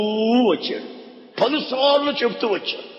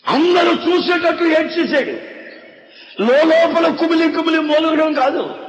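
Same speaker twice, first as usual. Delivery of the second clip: higher, raised proclaiming voice; preaching at full force; 3 semitones higher than usual. Acted. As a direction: preach it out to the whole church. Preaching on Jesus weeping.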